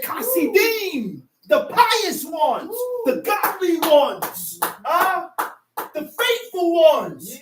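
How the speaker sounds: slow, stretched pulpit emphasis; emphatic preaching. Real, not acted. Preacher defining the pious ones, drawn-out emphatic preaching. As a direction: draw out each phrase like a preacher.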